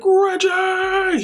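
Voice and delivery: high, stretched-out gleeful call; imitated excited shout. Dad greeting daughter's boyfriend, mimicked high excited holler. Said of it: "He's so excited to see him".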